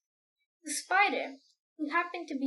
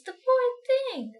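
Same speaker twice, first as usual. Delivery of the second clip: higher, louder cry of pity; ladybird crying out; 11 semitones higher, 8 dB louder than usual. Acted. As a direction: squeal it out in alarm for the poor boy. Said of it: "the ladybird cried".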